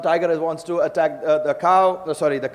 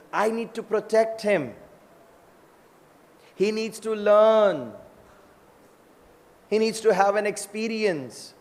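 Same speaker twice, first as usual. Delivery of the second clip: lightly voiced protective mother, higher pitch; a hint of motherly concern, pitched up; worried mother; 4 semitones higher than usual.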